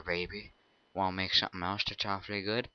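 low, soft flirt; seductive. Acted. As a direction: purr it suggestively.

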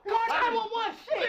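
high, loud shout for help; panicked crying out. Acted. Friend still pleading for nine one one, panicked crying out. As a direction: shout it like a frightened crybaby. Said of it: "Shut your crying baby ass".

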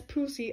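"pussy" mimicked as POO-see; French-style pronunciation. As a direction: quote "pussy" with a long "oo". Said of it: "unless they're French".

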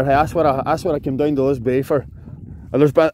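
ordinary talk, nothing marked

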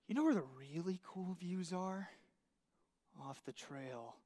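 hushed, tempting murmur; sly friend. Retold or imitated. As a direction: lure them off the trail softly.

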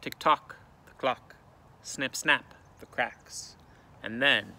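ordinary delivery, nothing marked

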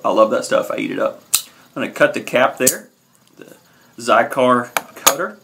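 ordinary talk, nothing marked

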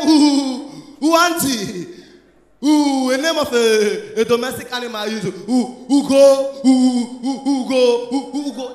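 loud stuttering ooh-ooh, stuck on the sounds; stammering boy. Retold or imitated. Nicodemus stammering an answer to the teacher, loud mock stutter. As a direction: hammer the stammer, dragging slowly. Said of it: "say stammerer, the way they say they talk".

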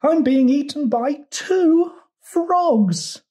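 very high, slow fly lament; squealing fly. A narrator's reading. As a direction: wail it out in a tiny insect voice. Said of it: "squealed the fly".

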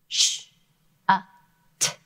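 "Sh-, uh, t." each sound apart. Shown as a demonstration. Teacher segments the word for class. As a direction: break "Shut" into sh, uh, t. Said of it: "That is a short vowel".

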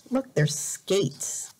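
usual speaking voice

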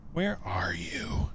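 spooky whisper; hushed ghostly call. Acted.

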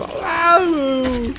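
high mock cartoon cry of waouh; imitated cactus cry. Streamer imitating the cactus death cry, high squeal. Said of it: "Dès qu'il m'a fait".

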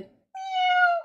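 high kitten meow. Pretend. Reading about a kitten to children, high cat meow. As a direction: mew like a tiny kitten.

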